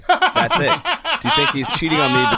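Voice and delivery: words squeezed out through real laughter, pitched high and loud; can't keep a straight face reading the letter; laughing while reading. Real, not acted. Reading listener letter, cracking up.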